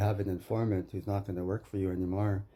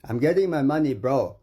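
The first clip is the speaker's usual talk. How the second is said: loud, higher vow with "getting" punched; angry shout; 6 semitones higher, 8 dB louder than usual. Real, not acted. A man yelling that he will collect his debt, angry. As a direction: shout the threat. Vowing to get his money back.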